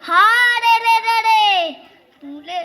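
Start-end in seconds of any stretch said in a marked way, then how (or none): 0.1-1.5 long ringing bandit yell; bandits' war cry. A narrator's reading. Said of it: "চেঁচিয়ে উঠল".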